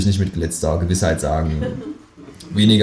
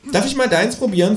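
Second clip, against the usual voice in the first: high, cheeky kangaroo ask; 13 semitones higher than usual. Acted. Kangaroo asks the narrator for a taste, high cheeky voice. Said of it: "fragt es".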